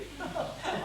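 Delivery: short airy chuckle under the breath; a quiet private snicker; soft breathy laugh. Acted. Seducer chuckling at husband calling him weird.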